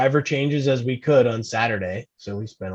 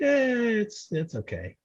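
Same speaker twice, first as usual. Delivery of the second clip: mock indifference; 11 semitones higher than usual. Partner unmoved by race car things, mock indifference. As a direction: imitate a lukewarm, unimpressed reply. Voices partner's lukewarm reaction.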